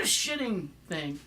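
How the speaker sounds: ordinary talk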